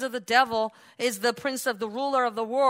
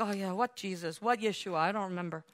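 mock forgetfulness; 6 semitones lower, 10 dB quieter, faster than usual. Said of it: "And like".

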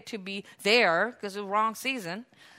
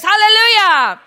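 exultant shout; 9 semitones higher, 14 dB louder than usual. Real, not acted. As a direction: belt it out to the congregation. Preacher's exultant praise shout.

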